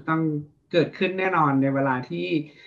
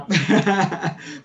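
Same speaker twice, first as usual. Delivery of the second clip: brief real laugh; a quick amused chuckle; short laugh; 4 semitones higher, 3 dB louder than usual. Real, not acted. Laughs at own admission. (หัวเราะสั้นๆ อย่างขำ)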